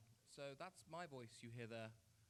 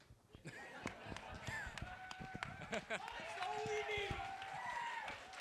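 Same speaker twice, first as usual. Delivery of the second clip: high squealing laugh; 4 dB louder than usual. Real, not acted. Laughs at own joke.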